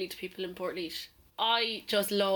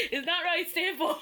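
laughing; 8 semitones higher than usual. Real, not acted. Laughing at a joke.

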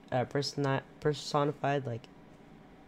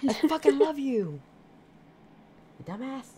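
playful mock insult; 12 semitones higher, 4 dB louder than usual. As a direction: tease with mock affection. Affectionate slow high insult.

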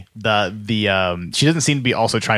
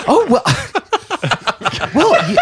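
flustered laugh; 10 semitones higher than usual. Acted. NPC host flustered laugh.

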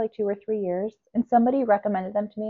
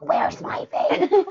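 exaggerated vape voice; 10 semitones higher, much slower than usual. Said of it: "imitates her vape voice".

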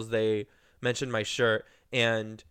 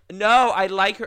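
loud, higher insistence over club noise; raised voice; 8 semitones higher, 9 dB louder, slower than usual. Retold or imitated. Clubgoer explaining the shirt over loud noise, raised voice. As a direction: call it out to be heard. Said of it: "but also it was so loud".

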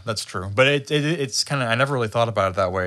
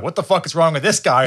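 louder, higher incredulous question; mock disbelief; 7 semitones higher, 6 dB louder than usual. Reacting to a finger-gun movie scene, loud mock disbelief. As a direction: burst out in baffled disbelief.

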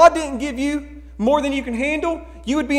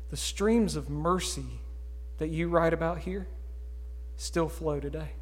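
softly quoting; 6 semitones lower, 11 dB quieter than usual. Retold or imitated. Woman's words to weeping man.

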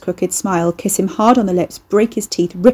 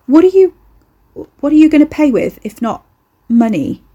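incredulous; 7 semitones higher, 6 dB louder than usual. Acted. Carrie reacts to Ricky's offer.

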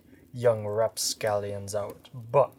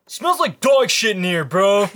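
higher, louder dudebro banter; bro voices; 10 semitones higher, 14 dB louder than usual. Acted. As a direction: banter like bratty teens. Voicing teens.

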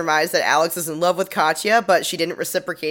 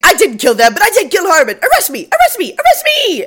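rising higher and louder on each arrest me; mock pleading; 12 semitones higher, 6 dB louder than usual. Suspect begging detectives, escalating shrill plea. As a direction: beg hysterically to be arrested.

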